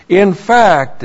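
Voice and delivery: forceful emphasis on "In fact"; emphatic. Shown as a demonstration.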